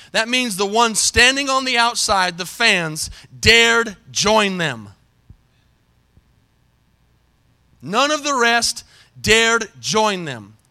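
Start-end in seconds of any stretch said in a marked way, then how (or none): 3.4-10.6 very slow, weighty verse reading; slow, deliberate scripture quote; reading kinda slow. Real, not acted. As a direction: draw the words out with pauses between. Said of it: "read them kinda slow".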